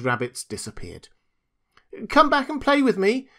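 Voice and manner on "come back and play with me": high, pleading little-rabbit call. A narrator's reading. Toy rabbit to fleeing rabbits, pleading call. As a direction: call after them, pitched way up. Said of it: "called the little rabbit".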